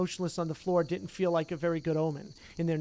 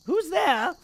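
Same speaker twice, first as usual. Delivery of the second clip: cackling poltergeist; 11 semitones higher, 5 dB louder than usual. Acted. Peeves spots them.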